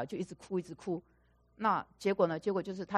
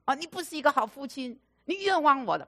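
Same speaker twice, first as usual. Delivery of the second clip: yelling at father; 7 semitones higher than usual. Retold or imitated. Son reliving childhood, yelling at his father. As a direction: build the yell up as the hurt pours out. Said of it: "就这样大叫".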